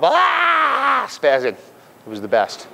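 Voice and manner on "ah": long, high held "aah"; imitated wild yell. Coach imitating one guy running off, high drawn-out yell. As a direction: imitate a guy yelling as he runs. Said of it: "he would actually go running off".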